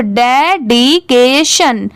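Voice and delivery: डेडिकेशन slowly split as de, di, ke, shan; syllable by syllable. Shown as a demonstration. Teacher joining the parts of a big word, डेडिकेशन syllable by syllable. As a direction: sound out डेडिकेशन in separate, stretched syllables. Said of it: "पूरा मिला देंगे तो".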